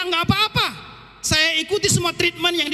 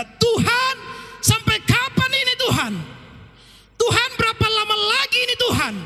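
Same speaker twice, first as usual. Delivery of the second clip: higher, pleading lament; anguished cry to God; 8 semitones higher than usual. Retold or imitated. Believer crying out to God in pain, higher pleading. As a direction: cry out to the Father in hurt. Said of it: "masa-masa menjerit dan berkata".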